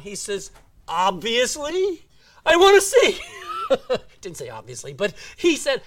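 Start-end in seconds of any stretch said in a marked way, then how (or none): 0.9-3.2 slow, high, loud comic retort; exasperated shout. Retold or imitated. Blind beggar answers Jesus' question, comically exasperated shout. 3.2-4.2 short real chuckle; laugh. Real, not acted. Preacher amused by his own embellishment, chuckling.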